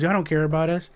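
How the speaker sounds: high retold protest; mock accusation. Mother pressing her complaint on the crowd, mock accusation.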